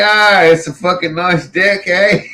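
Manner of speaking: mock Kiwi "dick" for "deck", praising it; mock Kiwi accent. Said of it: "that's what I say to the fucking neighbor".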